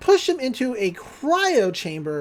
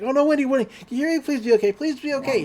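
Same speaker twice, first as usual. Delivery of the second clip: acted panic, higher pitched; panicked pleading; 6 semitones higher, much faster than usual. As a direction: plead in a rush, imitating a frantic boy. Dipper finds Wendy lying still.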